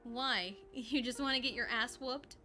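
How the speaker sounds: usual speaking voice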